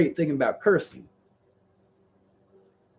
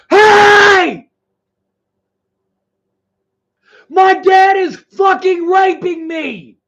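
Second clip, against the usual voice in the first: slow, much higher, much louder yelled plea; desperate shout; 13 semitones higher, 12 dB louder, much slower than usual. Retold or imitated. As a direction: scream it at a friend who won't listen. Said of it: "Finally, you stop and you say".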